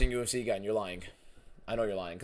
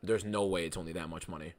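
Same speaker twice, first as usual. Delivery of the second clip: ordinary talk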